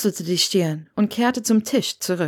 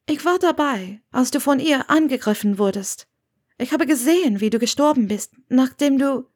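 slightly raised character voice; lightly voiced earnest woman; 6 semitones higher than usual. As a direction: voice a woman carefully explaining herself. Narrator voices Hermione.

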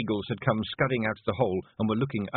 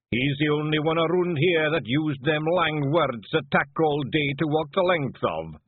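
raised, knowing Scots clansman voice; Scottish clansman; 8 semitones higher, 4 dB louder than usual. Acted. Clansman teasing his chief about long words, mocking. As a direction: needle the chief in broad Scots.

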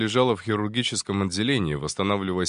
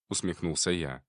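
ordinary delivery

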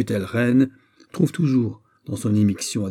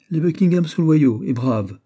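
bold declaration, higher and louder; courageous; 7 semitones higher, 7 dB louder than usual. Acted. Queen defends the Buckinghams to her son, courageous. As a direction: stand up firmly for them. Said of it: "dit courageusement Anne d'Autriche".

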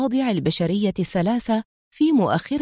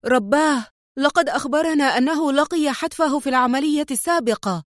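high-pitched, disbelieving outcry; shocked exclamation; 6 semitones higher than usual. A narrator's reading. Second diver sees a man believed dead, shocked high cry. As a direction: let shock lift the voice high. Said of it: "صاح رجل ثان من الضفادع البشرية".

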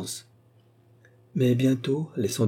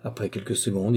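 ordinary delivery, nothing marked

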